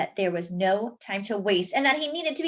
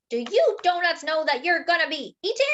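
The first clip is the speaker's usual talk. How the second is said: yelling; 6 semitones higher than usual. Acted. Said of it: "The baker yelled".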